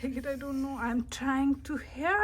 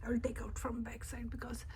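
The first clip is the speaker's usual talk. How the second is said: fast quiet whisper; 9 dB quieter, much faster than usual. Real, not acted. Kid whispering her plan, fast and hushed. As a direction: plot it under your breath. Planning the next pull.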